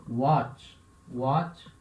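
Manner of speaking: watt said with a w sound, wot; teacher models watt with the w sound; english-style watt. Shown as a demonstration. Teacher models watt for students with wa. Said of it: "Também vai ter som de wa".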